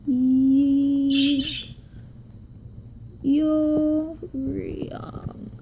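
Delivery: "Yi-, Yo-, Ryong" stretched and held high, fading on Ryong; drawn-out sounding out. Shown as a demonstration. Teacher working through the character name, "Yi-, Yo-, Ryong" drawn out. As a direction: sound out "Yi-, Yo-, Ryong" syllable by syllable, each one held.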